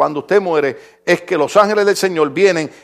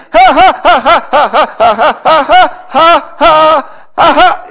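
high, loud ha-ha laugh; loud staged laughter; 8 semitones higher, 5 dB louder, much slower than usual. Retold or imitated. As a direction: laugh it out in rhythm. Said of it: "él decía". (carcajada fuerte y aguda, ja ja ja)

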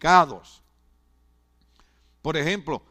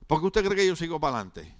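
normal talking voice

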